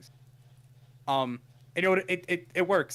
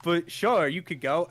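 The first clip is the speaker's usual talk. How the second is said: wary; 4 semitones higher, 4 dB louder, much slower than usual. Retold or imitated. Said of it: "She goes".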